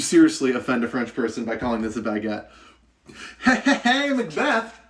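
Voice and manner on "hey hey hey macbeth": quick high sing-song hey hey hey; excited greeting. Real, not acted. Greeting another viewer in chat.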